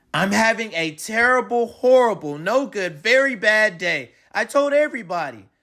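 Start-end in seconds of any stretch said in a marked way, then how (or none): none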